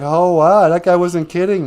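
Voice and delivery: acted wide-eyed astonishment; amazed. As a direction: react as if stunned it was true. Jack sees the giant beanstalk.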